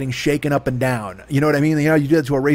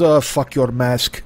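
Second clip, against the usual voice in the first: slow, dismissive mock German accent; German-accented neighbor; slower than usual. Neighbor brushes off the mask apology, imitated German accent. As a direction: drag it out, lightly German. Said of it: "he sounds like he's German".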